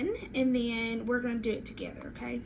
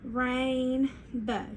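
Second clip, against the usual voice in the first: "rainbow" drawn out as RAIN... BOW; syllable-by-syllable "rainbow", clean gap between rain and bow; stretched into syllables. Shown as a demonstration. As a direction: stretch "rainbow" slowly into two separate parts. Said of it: "we're gonna stretch it out".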